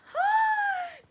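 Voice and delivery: loud high gasp of delight; excited gasp. Real, not acted. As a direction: gasp loudly in happy surprise. Recognises a viewer.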